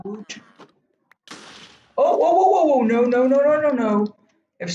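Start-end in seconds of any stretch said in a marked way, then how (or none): 2.0-4.1 high, loud rising panic; panicked whoa. Real, not acted. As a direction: cry out as it all falls apart. Something goes wrong in game.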